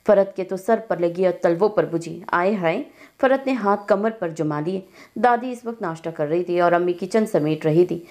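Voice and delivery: plain reading voice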